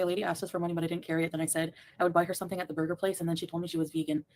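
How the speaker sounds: usual speaking voice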